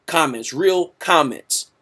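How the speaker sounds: slow, with "real" leaned on hard; stress on real. Shown as a demonstration. Webinar host to his audience, slow with "real" pressed. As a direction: drag out "real comments" so the word "real" lands heavy. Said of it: "I, I stress the word real".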